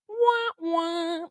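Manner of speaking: soft wah wah sound; mock sad trombone. Teacher mocks going back to an ex, sad trombone.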